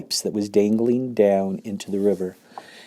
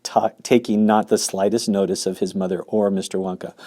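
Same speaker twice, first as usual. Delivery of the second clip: plain reading voice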